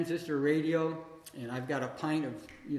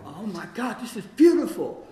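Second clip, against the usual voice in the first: high, loud, laughing delight; retold excitement; 4 semitones higher, 7 dB louder than usual. Finding a stray cat under the house, giddy laughing delight. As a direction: gush with giddy joy at the find. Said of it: "And I'm all excited".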